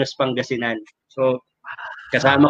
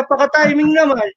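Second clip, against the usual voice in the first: lightly voiced mother, pitched high; a hint of a nagging mom; mom's voice up high, teasing; mother's high voice; 9 semitones higher, 3 dB louder than usual. Mother voiced in retold call.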